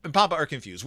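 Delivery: normal talking voice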